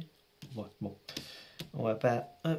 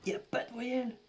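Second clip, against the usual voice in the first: much higher, strained repeat of voyelle; higher pitched real despair; disbelief; 9 semitones higher, faster than usual. Real, not acted. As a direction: squeak out "voyelle" in dismay.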